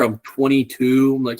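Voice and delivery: normal talking voice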